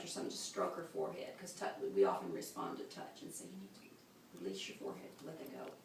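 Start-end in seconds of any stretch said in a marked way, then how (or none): 3.4-5.7 hushed, much softer near-whisper; gentle coaching. Acted. Partner coaching a laboring woman to release tension, soft and hushed. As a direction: coax softly, barely voiced.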